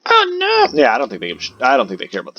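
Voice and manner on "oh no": high, loud sudden cry; oh no yelp; startled. Real, not acted. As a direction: yelp in alarm. Sudden game event.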